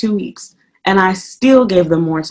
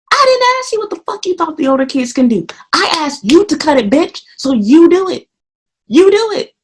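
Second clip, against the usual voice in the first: angry yelling; 9 semitones higher than usual. Retold or imitated. Ranting at coworker, rising yell.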